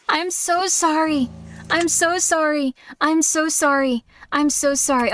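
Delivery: slow, stretched-out so sorry; drawn-out apology. Real, not acted. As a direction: drag each sorry out slowly.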